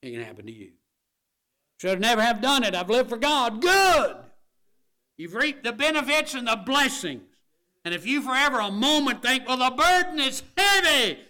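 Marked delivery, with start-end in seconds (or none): 1.8-11.2 high, full-force shout; impassioned preaching. Real, not acted. Preacher addressing those who never strayed, impassioned shout. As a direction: shout it from the pulpit, high and loud.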